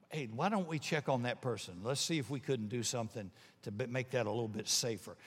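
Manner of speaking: quiet, gentle church member voice; soft caring suggestion. Retold or imitated. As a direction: softly suggest checking on her.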